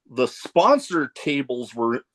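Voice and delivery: ordinary talk, nothing marked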